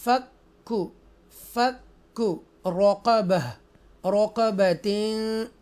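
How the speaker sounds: slow, drawn-out tajweed chant; Quran recitation. Shown as a demonstration. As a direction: recite each word slowly and melodically.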